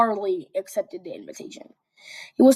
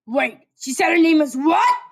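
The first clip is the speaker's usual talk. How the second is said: loud, higher stunned question; shocked; 7 semitones higher, 8 dB louder than usual. Acted. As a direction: demand it in shock. Shocked Katsuki.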